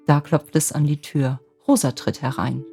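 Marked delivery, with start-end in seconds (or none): none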